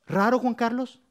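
playful high teasing question. Real, not acted. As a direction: tease him in front of everyone. Teasing a congregant mid-walk.

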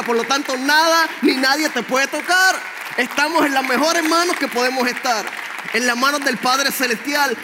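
loud, soaring sermon build; fervent preaching. Acted. Preacher proclaiming to the church, loud and fervent. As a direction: preach it full force to the congregation.

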